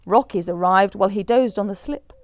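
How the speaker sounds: ordinary delivery, nothing marked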